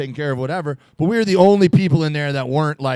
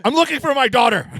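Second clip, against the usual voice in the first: raised-pitch mock worried father; worried dad; 8 semitones higher than usual. A comic telling a club girl he's looking for his daughter, mock-worried dad. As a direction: play the frantic dad, lightly. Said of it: "I looked at her and I go".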